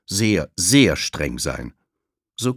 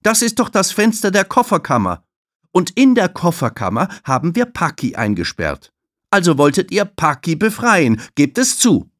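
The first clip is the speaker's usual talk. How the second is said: high, loud stern challenge; stern receptionist, raised and high; Tulile calling out; 11 semitones higher, 7 dB louder than usual. Acted. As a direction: scold the two kids sharply. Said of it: "ruft sie".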